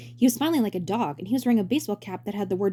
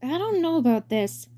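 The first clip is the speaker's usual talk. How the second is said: hesitant; 8 semitones higher, slower than usual. Acted. Ludwig hesitant.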